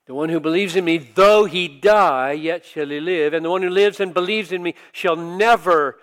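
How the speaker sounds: loud preacher's proclamation of scripture; full-force preaching. Acted. Preacher proclaiming Jesus' words at Lazarus' tomb, loud. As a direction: declare it to the whole church.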